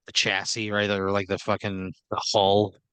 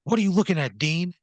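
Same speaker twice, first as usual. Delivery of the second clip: higher-pitched defensive question; embarrassed challenge; 7 semitones higher, 3 dB louder than usual. Acted. Boss caught tearful by a worker, embarrassed and defensive. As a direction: cover embarrassment by challenging the onlooker. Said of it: "he's very embarrassed".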